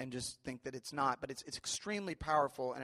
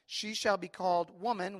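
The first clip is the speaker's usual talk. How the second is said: slow, higher, louder proclaiming of Adam's words; scripture reading; 5 semitones higher, 7 dB louder than usual. Acted. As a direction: announce it like a joyful discovery.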